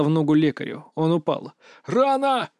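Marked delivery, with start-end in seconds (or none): 1.8-2.5 high, drawn cry of the wounded; cried out. A narrator's reading. Medic hit in the leg, high pained cry. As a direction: cry out high, without full volume. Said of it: "закричал".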